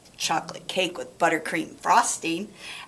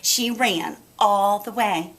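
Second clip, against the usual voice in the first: ordinary delivery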